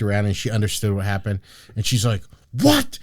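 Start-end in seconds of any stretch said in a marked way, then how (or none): 2.5-3.0 high-pitched exasperated snap, imitating; imitated irritated squawk; mock snapping. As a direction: snap it back in a much higher mock-annoyed voice. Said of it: "never snaps".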